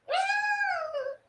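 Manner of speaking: very high, piercing mock shriek; imitated demon scream. Retelling a dream, mimicking the demons' high-pitched shriek. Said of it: "they were screaming at".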